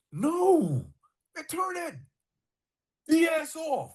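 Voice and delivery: high, whiny plea to turn it off; high pleading complaint; pleading protest. Retold or imitated. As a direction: beg him to kill the music. Pleading against the music.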